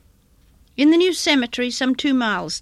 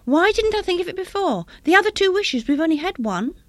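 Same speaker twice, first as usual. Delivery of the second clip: high-pitched, agitated wife; feverish, high cry of realisation; hysterical; 7 semitones higher than usual. A narrator's reading. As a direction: pour it out in rising excitement. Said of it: "she cried hysterically".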